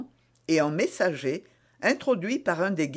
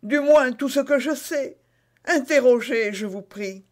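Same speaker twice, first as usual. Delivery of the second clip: higher-pitched eager suspect; Bonacieux empressé; 8 semitones higher, 5 dB louder than usual. Acted. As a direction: plead to be questioned. Voices Bonacieux under interrogation. (suspect empressé, voix plus aiguë)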